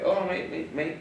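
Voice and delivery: light New Zealand accent, imitating a friend; a touch of Kiwi mate talk. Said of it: "do your Kiwi accent".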